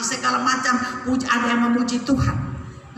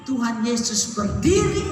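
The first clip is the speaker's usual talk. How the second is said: normal talking voice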